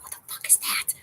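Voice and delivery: unvoiced shocked whisper; hushed disbelief. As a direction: hiss it under your breath. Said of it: "I was like".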